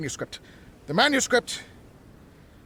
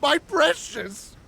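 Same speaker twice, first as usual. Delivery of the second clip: rising cackle through the words, climbing much higher; crazed laughing; 8 semitones higher, slower than usual. Acted. Mad researcher to his invention, cackling higher. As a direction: gloat over the machine, laughing.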